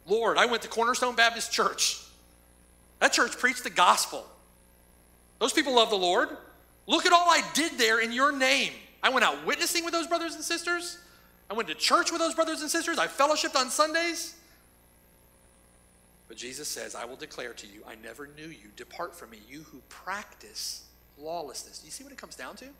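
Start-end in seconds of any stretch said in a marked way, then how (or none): 0.1-14.3 higher, louder self-justifying plea; protesting churchgoer. Acted. Preacher voicing a churchgoer pleading his works before the Lord, higher and louder. 16.9-21.8 solemn verdict. Acted. Jesus' verdict quoted quietly.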